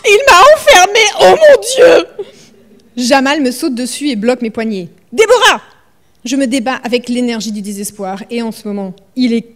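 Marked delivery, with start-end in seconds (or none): 0.1-2.3 high, loud panicked cry with a sob-laugh. Acted. Locked door, panicking. 5.1-5.6 high, loud shout of her name; calling her name. Acted. Jamal grabs her wrists.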